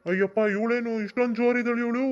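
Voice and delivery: high, loud shocked outburst; startled. Acted. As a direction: recoil in alarm at her temper. Man shocked by her fury.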